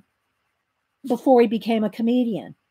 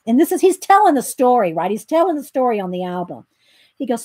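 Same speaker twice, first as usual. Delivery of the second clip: ordinary talk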